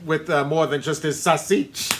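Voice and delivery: a hammed-up gangster threat; mobster threat. Repeats mobster threat, laughing.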